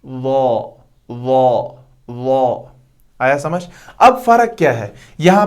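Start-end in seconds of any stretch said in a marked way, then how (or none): 0.0-2.6 वॉ said heavy with the tongue tip at the teeth; भारी वॉ; Arabic letter demo. Shown as a demonstration. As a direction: drill वॉ heavy and rounded. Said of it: "ऐसे".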